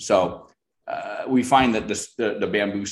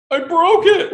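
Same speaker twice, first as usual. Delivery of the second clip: panicked; 12 dB louder, slower than usual. Retold or imitated. Grower panics over cracked offshoot.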